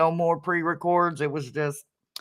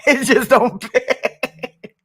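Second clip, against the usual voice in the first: high, loud, drawn out through laughter; laughing; 12 semitones higher, 6 dB louder, much slower than usual. Real, not acted. Host amused that the tiny voice don't fit, laughing hard. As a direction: crack up while saying it. Cracking up at the mismatch.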